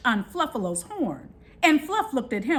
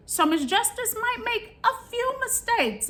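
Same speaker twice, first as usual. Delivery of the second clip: playful high voice; 13 semitones higher than usual. Real, not acted. Joking about self in third person.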